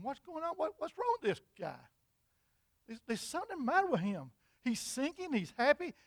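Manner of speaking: a touch of bewildered disbelief; mildly baffled. Acted. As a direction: wonder quietly what's wrong with him. Soldier puzzled by cheerful man.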